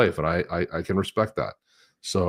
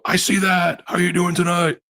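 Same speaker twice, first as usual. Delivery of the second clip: much higher, pumped-up trolling voice; mock wrestler hype; 11 semitones higher, 3 dB louder than usual. Host trolling Hulk Hogan at the bar, hammed-up wrestler hype. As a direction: needle him in wrestler style. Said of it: "I go".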